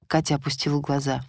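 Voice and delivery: ordinary delivery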